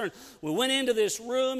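usual speaking voice

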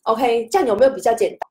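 normal talking voice

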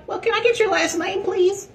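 very high, prissy mock voice; gym Karen voice. Karen asking for his last name, in a very high prissy voice. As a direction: squeak it like a fussy desk clerk. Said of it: "She like".

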